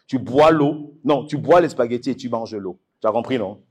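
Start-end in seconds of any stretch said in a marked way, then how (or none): none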